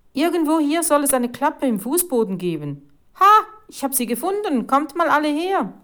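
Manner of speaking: high, loud, eager calling; excited discovery. A narrator's reading. Boy announcing he has found the hatch, excited. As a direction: summon everyone to the find.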